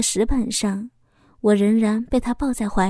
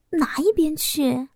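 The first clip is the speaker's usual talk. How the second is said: higher, bashful brush-off; coy and shy; 5 semitones higher than usual. A narrator's reading. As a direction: shoo him away coyly. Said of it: "娇羞地说着".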